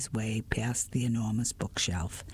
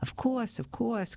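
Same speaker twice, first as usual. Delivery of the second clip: much higher, eager and quick; eager agreement; 10 semitones higher than usual. Acted.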